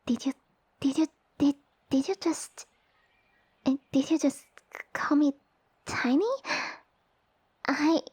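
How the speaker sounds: halting, sputtering disbelief rising in pitch; affronted stammering. Acted. Noble called tiny by a human, flustered and affronted. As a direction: stumble over the words, scandalised.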